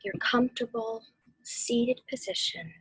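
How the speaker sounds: slow, hushed meditation guidance; guided meditation whisper. Acted. Guiding the family through meditation, slow whisper. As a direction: breathe the words out gently, unhurried.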